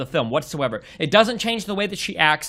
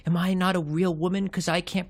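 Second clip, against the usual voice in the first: a touch of whimpering, softer; imitating the heroine crying over not being a real woman, mock-soft; 6 dB quieter than usual. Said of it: "when she starts crying, she's like".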